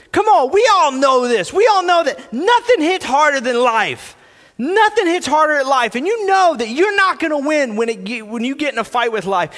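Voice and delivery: fired-up preacher; impassioned preaching. Real, not acted. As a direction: press the point on the congregation forcefully. Preaches at raised force.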